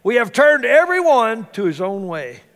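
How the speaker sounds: Isaiah as a hammed-up Texan preacher; Texan accent. Preacher voicing Isaiah as a Texan, mock Texan drawl. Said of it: "If Isaiah would have been a Texan".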